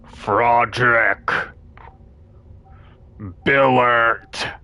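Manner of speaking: slow, drawn-out call over a store intercom; eerie intercom voice. Retold or imitated. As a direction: announce the name slowly like a creepy PA. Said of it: "a voice coming in the intercom".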